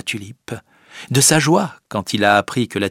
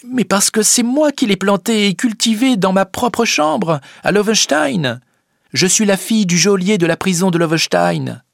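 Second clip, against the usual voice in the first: put-on a young girl's raised, earnest voice; Rosa's voice; 11 semitones higher, 4 dB louder than usual. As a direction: plead her case firmly. Rosa answers.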